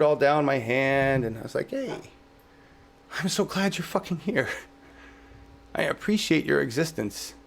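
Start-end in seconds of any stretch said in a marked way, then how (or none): 1.7-7.3 tender talk to bugs. Retold or imitated. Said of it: "talk like that all the time".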